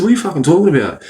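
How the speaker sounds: fast, high, mock-outraged protest; indignant outburst. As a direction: fire it back fast and high, playing wronged. Acts out his protest.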